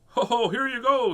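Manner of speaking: high, loud jolly storybook voice; Daddy Pig. A narrator's reading. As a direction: boom it out like a jolly cartoon dad.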